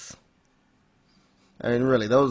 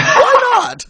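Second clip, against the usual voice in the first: much higher, louder cry through a laugh; mock outraged protest; 11 dB louder than usual. Would-be riders arguing with Death, mock outraged protest. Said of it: "It's like".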